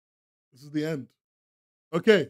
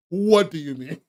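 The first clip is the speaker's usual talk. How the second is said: ordinary talk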